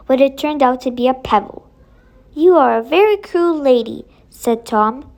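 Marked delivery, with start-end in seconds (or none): 2.3-4.0 Tom's hurt, reproachful voice, a little louder; a boy hurt by a cruel trick; child accusing. Acted. Tom accuses the stern fairy.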